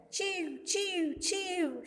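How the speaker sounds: high, soft little chirps; sparrow chirp imitation. Shown as a demonstration. Teacher shows children how a sparrow chirps, high and soft. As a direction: peep like a tiny sparrow. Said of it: "She's doing now?".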